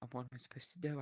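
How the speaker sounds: ordinary talk, nothing marked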